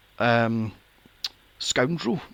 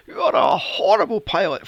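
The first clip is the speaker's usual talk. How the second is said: loud, higher, stressing ARE; exasperated outburst; 4 semitones higher, 7 dB louder than usual. Retold or imitated. Shouting at the pilot in exasperation. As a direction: blurt it out in disbelief. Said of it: "I'm like".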